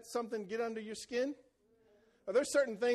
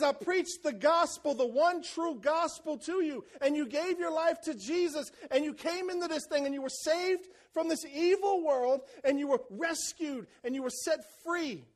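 pleading Paul; 6 semitones higher than usual. Retold or imitated. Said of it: "Paul says".

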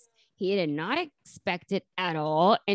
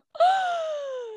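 big shocked gasp. Retold or imitated. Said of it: "that makes you go".

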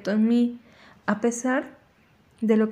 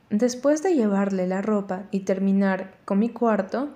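plain reading voice

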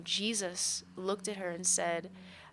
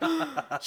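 mock gasp; 8 semitones higher, 6 dB louder than usual. Imitating people's shocked reaction.

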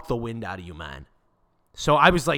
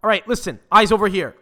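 higher, louder barked order; bossy stewardess; 7 semitones higher, 8 dB louder than usual. Retold or imitated. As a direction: command the whole cabin's attention. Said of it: "she's like".

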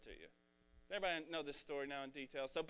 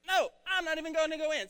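higher, louder mock tantrum refusal; 11 semitones higher, 10 dB louder than usual. Preacher acting the older brother's mock tantrum. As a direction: stomp it out like a sulky five-year-old. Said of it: "So he basically threw a tantrum".

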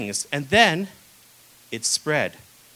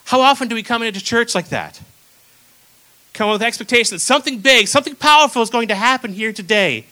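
preaching; 4 semitones higher, 6 dB louder than usual. Real, not acted. Preacher challenges congregation.

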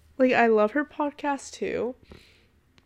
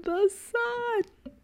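squealed much higher on the repeat; dreamy excitement; 11 semitones higher than usual. Real, not acted. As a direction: swoon over the sun, voice soaring. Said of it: "I'm so excited".

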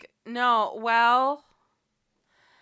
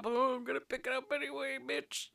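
higher, fast mocking voices for both characters; mock bickering characters; 6 semitones higher, faster than usual. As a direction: play the quarrel as a quick high-pitched mockery. Acting out characters' exchange.

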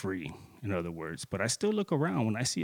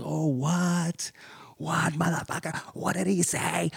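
raised, unvoiced whisper of disbelief; stunned fury; 6 semitones higher, slower than usual. Man hearing the insult, whispering in outraged disbelief. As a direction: hiss out the shock, pitched up.

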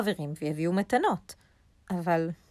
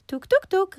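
high, loud knock-knock sound; knocking noise; 13 semitones higher, 6 dB louder than usual. A narrator's reading. Rabbit knocks on hare's door, high vocal knocks. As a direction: imitate sharp door knocks with the voice.

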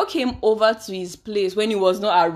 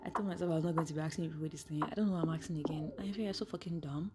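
embarrassed whisper; 3 semitones lower, 12 dB quieter, faster than usual. Real, not acted. Host admits to listeners she feels dumb asking again, whispering. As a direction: drop to a breathy, voiceless whisper, much softer. Embarrassed aside muttered to herself.

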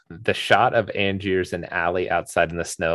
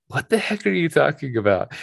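baffled; 8 semitones higher than usual. Retold or imitated. Confused people retold.

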